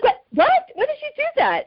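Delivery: high, puzzled what; a startled woman asking, voice jumping up; confused what. Retold or imitated. Woman startled by water on her head, confused and high. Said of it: "And she's like".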